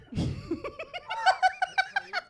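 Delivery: long, very high squeal of laughter. Real, not acted. Comic amused by own line, squealing laugh.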